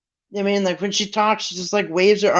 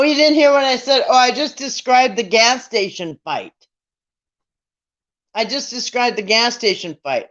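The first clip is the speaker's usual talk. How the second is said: normal talking voice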